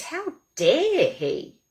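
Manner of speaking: high, slow, stretched-out outrage; indignant anger. Shown as a demonstration. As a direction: draw out DARE in demonstrated indignation. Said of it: "I feel furious".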